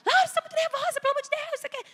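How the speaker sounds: high, loud exasperated telling-off; acted fed-up mother, pitch shooting up; scolding Laura. Mother fighting with her stressed daughter, high exasperated scolding. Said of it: "Não adiantava eu brigar com ela".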